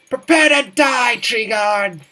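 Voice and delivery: villain battle cry. Acted. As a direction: roar it like a mighty monarch. Attacking as the Beaver Monarch.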